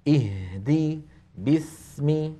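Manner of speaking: "اهدي" and "باسم" with equally held h and s; equal letter length. Shown as a demonstration. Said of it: "نفس الطول".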